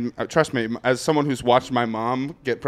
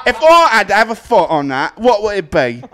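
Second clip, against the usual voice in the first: high, loud, hammed-up British accent; mock British accent; 6 semitones higher, 6 dB louder than usual. Comic spoofing someone who talks British, mock British accent. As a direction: push a silly British accent until it cracks you up. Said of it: "if you're gonna talk British".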